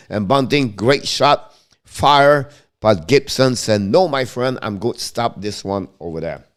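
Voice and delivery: running hockey commentary, steady and brisk; live play-by-play. Acted. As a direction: call the game as it happens.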